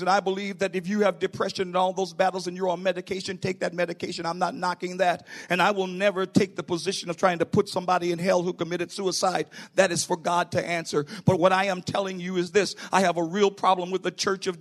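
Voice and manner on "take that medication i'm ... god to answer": ordinary talk, nothing marked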